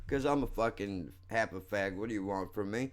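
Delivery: ordinary delivery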